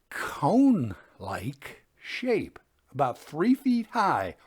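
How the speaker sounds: usual speaking voice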